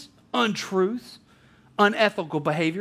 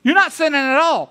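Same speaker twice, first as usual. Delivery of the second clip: higher and louder "whoa, whoa" to stop a friend; retold hold-up call to an upset friend, urgent; urgent calming; 5 semitones higher, 4 dB louder than usual. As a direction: cut in firmly, bro to bro. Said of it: "I said".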